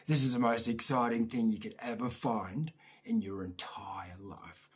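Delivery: hushed, awed whisper. Real, not acted. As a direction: whisper it like a secret treasure. Hushed awe about a kid's find.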